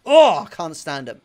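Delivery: a real loud angry burst; angry outburst. Real, not acted. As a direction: blurt it out loud in real anger. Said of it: "Gets me angry".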